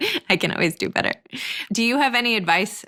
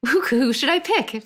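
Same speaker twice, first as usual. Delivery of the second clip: slow, high-pitched playful indecision; lightly acted, drawn-out wondering; mock dithering; 6 semitones higher than usual. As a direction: dither aloud over too many choices. Acts out being spoiled for choice.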